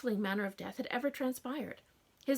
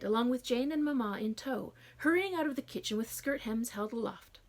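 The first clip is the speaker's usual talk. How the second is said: plain reading voice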